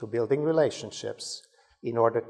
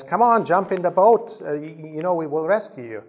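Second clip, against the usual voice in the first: raised, higher-pitched urging call; rescuer's urgent holler; 5 semitones higher, 6 dB louder than usual. Acted. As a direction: call out to someone to climb aboard. Boatman calls to flood victim.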